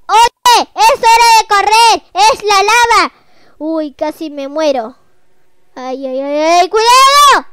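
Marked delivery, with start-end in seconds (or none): 0.1-3.1 high loud urgent warning; urgent shout. Pretend. Lava chase in game. 6.4-7.5 scared warning. Pretend. Near death in game.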